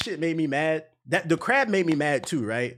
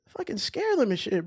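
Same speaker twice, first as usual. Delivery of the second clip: normal talking voice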